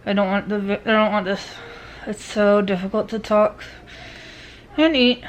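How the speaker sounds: speaking through wired teeth; talking with mouth closed. Real, not acted. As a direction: talk with jaw wired shut. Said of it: "Just very hard to talk".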